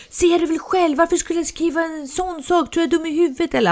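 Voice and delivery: high, quick exasperated protest; retold indignation, pitched well up and speeding up; upset, indignant. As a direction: imitate an offended son snapping back. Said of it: "Han blev liksom upprörd".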